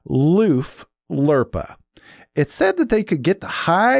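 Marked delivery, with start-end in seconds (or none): 0.1-1.8 Luf Lurpa said very slowly, each syllable apart; slow, deliberate. Shown as a demonstration. Host repeats the name slowly for listeners. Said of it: "Let me say it again".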